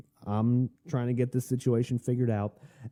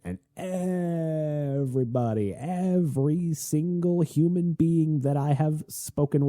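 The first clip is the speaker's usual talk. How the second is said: drawn-out, higher-pitched emphasis on every word; emphatic exaggeration; 7 semitones higher, slower than usual. Real, not acted. Newcomer stressing that everyone up here has the accent, emphatic and drawn out. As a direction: stretch it out slowly and high for hyperbole.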